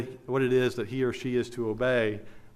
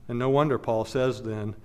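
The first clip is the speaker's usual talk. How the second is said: normal talking voice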